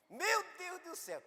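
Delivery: "Meu Deus do céu" squealed high and breathless like a thrilled woman; excited squeal. Retold or imitated. Woman reacting to a miracle, "Meu Deus do céu" in a high squeal. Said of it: "de mulher pra mulher".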